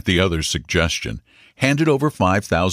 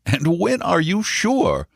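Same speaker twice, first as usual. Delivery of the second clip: ordinary delivery, nothing marked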